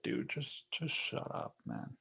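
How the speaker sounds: soft, breathless hush-up; whispered brush-off. Retold or imitated. Telling symbolic-story writers to stop, whispered brush-off. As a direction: hiss it quietly like telling them to hush.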